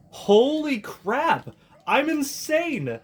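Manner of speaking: high, slow amazed yelling; excited shout. Real, not acted. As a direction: shout it out in disbelief. Guessed final boss right.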